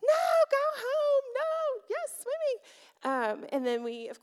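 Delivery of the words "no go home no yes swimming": high-pitched wailing protest; imitated child meltdown. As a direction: imitate a screaming, upset child. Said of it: "is just losing her mind".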